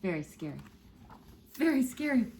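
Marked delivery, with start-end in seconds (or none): 0.1-0.6 low, quiet mock-scared murmur; hushed. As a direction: say it softly like a secret fright. Reader repeats phrase to kids.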